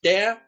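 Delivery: Der said loud and high, punched; stressed Der. Shown as a demonstration. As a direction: hit Der hard and high. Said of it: "deux choses à retenir".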